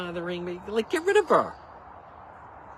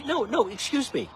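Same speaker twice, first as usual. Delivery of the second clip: high, loud indignant retort; indignant no, excuse me; annoyed rebuttal; 8 semitones higher, 5 dB louder than usual. Real, not acted. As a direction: push back, offended. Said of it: "That was annoying though".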